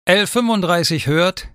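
brisk, routine answer into the mic; routine radio reply. A narrator's reading. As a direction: answer the radio like a practised officer. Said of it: "antwortete sie routiniert".

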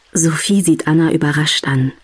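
plain reading voice

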